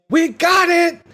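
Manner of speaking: loud, high-pitched yell; Roman's yell. Retold or imitated. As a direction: belt it out like a movie-hero shout. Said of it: "Roman yells".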